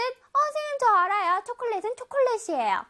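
high, eager pupil piping up. Acted. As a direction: play a keen child sure of the answer. Voices an eager pupil answering.

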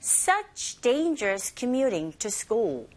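very slow, word by word; slow drill reading. Shown as a demonstration. Teacher leading learners through a slow repetition drill. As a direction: say it slowly for students to repeat. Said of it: "고고 listening one".

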